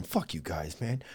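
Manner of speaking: low, soft imagined grumble; quietly fed-up pretend quote; muted mock resentment. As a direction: play the snubbed actor under your breath. Character actors' imagined grumbled reaction.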